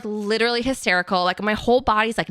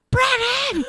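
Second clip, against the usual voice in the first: high-pitched yell for someone; acted scream; 3 dB louder than usual. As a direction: scream the name across the house. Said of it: "And I fucking scream. I'm like".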